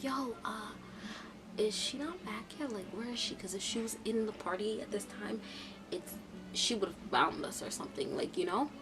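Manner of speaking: very soft, hushed murmur; retold whisper. Friend asking after Brooklyn with police outside, hushed. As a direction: keep it way down, as if police could hear. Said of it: "so I whispered, I'm like".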